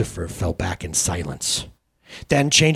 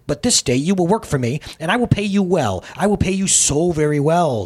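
high, loud, frantic bargaining; Sin shrills her offer, almost unhinged; shrill hysterical; 7 semitones higher, 5 dB louder than usual. Acted. Sin hysterically bargains with the carpenter, high and loud. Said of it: "shrilled Sin hysterically".

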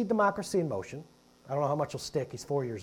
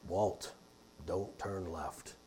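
much lower and very slow, like a movie God from the clouds; mock booming God voice; 11 semitones lower, 12 dB quieter, much slower than usual. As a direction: parody a deep heavenly voice booming down. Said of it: "he's not gonna sound like this".

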